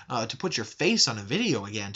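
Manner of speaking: normal talking voice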